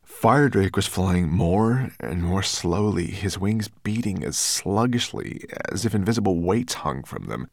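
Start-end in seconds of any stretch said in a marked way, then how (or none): none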